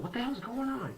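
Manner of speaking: high mock voice of an alarmed mother; mocking mom. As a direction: squeal it like his mom. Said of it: "my mom comes like".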